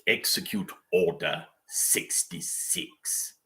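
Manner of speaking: slow, low sinister whisper; tempting devilish whisper. Pretend. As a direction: give the order coldly. Palpatine cosplayer quoting Sidious.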